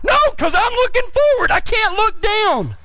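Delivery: high-pitched indignant comeback; exasperated protest. Retold or imitated. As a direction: argue back high, dragging the last words. Arguing back at his instructor.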